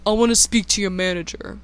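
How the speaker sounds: fast, put-on entitled Karen demand; mock the customer's manager demand. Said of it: "being a complete Karen and saying".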